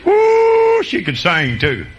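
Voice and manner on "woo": high, loud joyful woo; excited whoop. Real, not acted. Preacher whoops remembering her singing, high and loud. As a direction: let out a whoop of delight.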